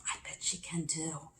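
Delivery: hushed unvoiced whisper. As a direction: whisper it like a secret aside. Animals whispering aside.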